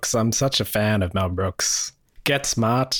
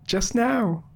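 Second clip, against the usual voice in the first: bad movie reenactment; 10 semitones higher than usual. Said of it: "that was a bad reenactment of the movie".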